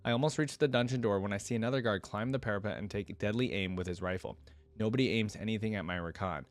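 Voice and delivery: ordinary delivery, nothing marked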